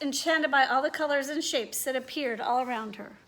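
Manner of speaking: normal talking voice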